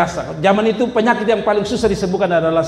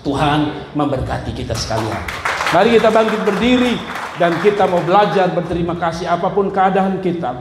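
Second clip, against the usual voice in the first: ordinary talk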